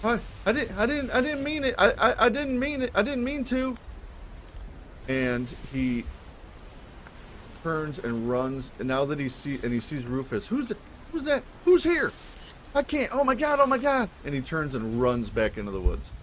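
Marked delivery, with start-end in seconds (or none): 0.0-3.8 put-on high, stammering young man; younger man's voice. Young man caught near the woods, high stammering panic. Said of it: "a younger man's voice". 10.5-14.1 put-on high, panicked young man; rising panic in a high-pitched voice; younger man's voice. Young man spotting Rufus before fleeing, high panic. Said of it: "a younger man's voice".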